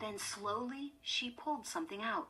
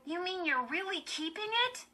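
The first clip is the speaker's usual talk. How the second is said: high-pitched disbelief; incredulous cry; 12 semitones higher, 4 dB louder than usual. A narrator's reading. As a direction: cry out, unable to believe it. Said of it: "Chris cried".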